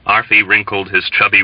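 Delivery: ordinary delivery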